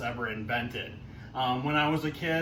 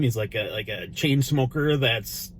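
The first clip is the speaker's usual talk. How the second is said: usual speaking voice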